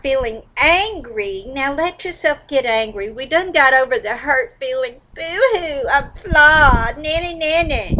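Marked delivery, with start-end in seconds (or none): 5.1-8.0 mock whining. Mocking the hurt feeling.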